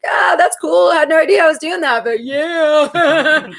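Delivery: hammed-up pleased surprise; acted-out delight. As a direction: act out your own tickled reaction, ending in a laugh. Acts out own pleased reply.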